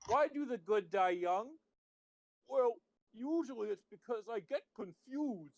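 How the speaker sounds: high, halting, badly done character impression; Grim Reaper. Player giving a hint to his identity, terrible Grim Reaper impression. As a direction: attempt a cartoon Reaper, pitched well above your own voice. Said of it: "That was a terrible impression".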